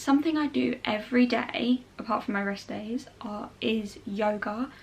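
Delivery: ordinary talk, nothing marked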